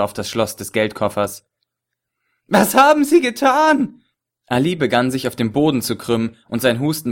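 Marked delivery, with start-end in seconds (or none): 2.5-4.0 high, loud, horrified cry; horrified shout. A narrator's reading. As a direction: scream it in shock and horror. Chess entsetzt über Vergiftung. (entsetzter Aufschrei)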